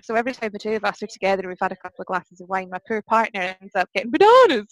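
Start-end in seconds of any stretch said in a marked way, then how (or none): 4.1-4.7 "bananas" yelled high and loud; tipsy teasing shout of "bananas". Retold or imitated. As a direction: bellow "bananas" at the partner. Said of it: "shouted at him".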